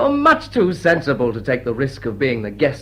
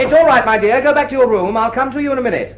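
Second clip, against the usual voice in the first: loud, much higher, calling through a door; 10 semitones higher, 6 dB louder, faster than usual. Acted. Dilling calling through the door to Mrs Ebley, loud and high. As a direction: call out to reassure someone outside.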